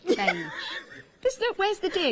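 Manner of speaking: very high, laughing indignation; indignant protest. Real, not acted. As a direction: squeal the challenge through a laugh. Laughing protest when accused.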